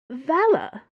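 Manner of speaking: high, loud, astonished question; disbelieving cry of the name. A narrator's reading. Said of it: "Madame Su said in astonishment".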